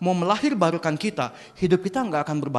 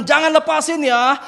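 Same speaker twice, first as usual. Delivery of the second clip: loud, raised-pitch promise; urgent father; 10 semitones higher, 8 dB louder than usual. Acted. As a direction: call it to your dangling son. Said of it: "papanya bilang".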